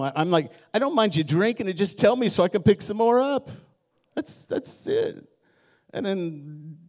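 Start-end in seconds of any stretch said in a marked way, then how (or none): 0.7-5.2 pleading dad. Retold or imitated. Dad pleading with his boys.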